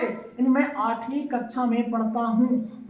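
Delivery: ordinary delivery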